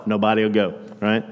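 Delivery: ordinary delivery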